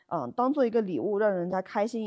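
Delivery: normal talking voice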